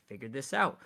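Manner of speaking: normal talking voice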